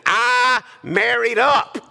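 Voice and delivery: high, drawn-out exclamation; playful, high-pitched proud declaration; emphatic boast. Real, not acted. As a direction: crow it slowly to the crowd. Jokingly boasts about his wife.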